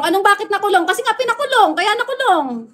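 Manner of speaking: as if the answer were obvious; exasperated retort. Real, not acted. To chatters asking why Duterte was jailed, exasperated and high.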